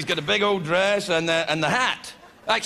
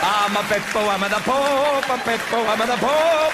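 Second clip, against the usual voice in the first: chanting, silly mock Italian accent; the Pope; 3 semitones higher, 3 dB louder than usual. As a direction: crow it in singsong Italian accent, building louder. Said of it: "he's not even Italian anymore".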